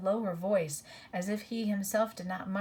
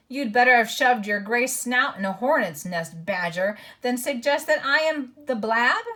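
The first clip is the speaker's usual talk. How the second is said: angry dwarf; 5 semitones higher, 9 dB louder than usual. A narrator's reading. Dwarf retorts in anger.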